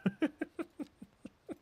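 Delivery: a squeaky, incredulous giggle; high-pitched laugh. Real, not acted. Laughing at game mishap.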